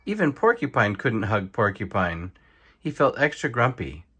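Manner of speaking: plain reading voice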